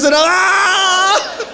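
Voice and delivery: high sharp yell of pain; pained scream. Retold or imitated. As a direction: cry out as the acid hits. Pepper acid in his eyes.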